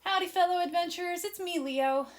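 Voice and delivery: bright, loud greeting, a bit fast; excited kid host. Acted. Leo greeting his fellow adventurers, excited. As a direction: greet the adventurers like an eager boy.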